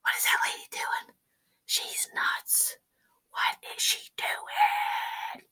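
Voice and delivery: hushed unvoiced whisper; gossiping neighbours whisper. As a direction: murmur as if scandalised neighbours. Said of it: "They're like".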